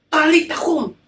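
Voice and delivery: loud, high call of the command; high loud "Talita kum"; commanding Jesus. Acted. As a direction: call her awake.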